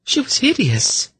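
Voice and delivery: put-on very high, horrified girl's voice. A narrator's reading. Girl asking about the priestess's face, horrified. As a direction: squeak it out in horror. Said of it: "in a horrified voice".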